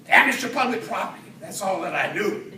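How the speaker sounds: ordinary delivery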